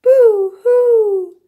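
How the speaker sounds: high wailing boo-hoo; loud crying. A narrator's reading. Little beaver alone by the pond, wailing. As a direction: wail it out like a sobbing child. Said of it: "said the voice from across the pond".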